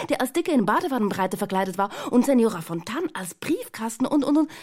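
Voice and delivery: fast, high, loud tumbling words without a breath; an excited girl gushing about costumes without pausing to breathe; breathless excitement. A narrator's reading. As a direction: rattle it all off, one gulp of air. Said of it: "ohne Luft zu holen".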